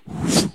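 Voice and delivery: loud whoosh of spears; whoosh sound. Retold or imitated. Telling of spears hitting the pilot, loud whoosh.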